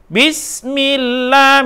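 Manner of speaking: "Bismillah" drawn out slowly; slow recitation. Shown as a demonstration. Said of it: "dibaca Allah".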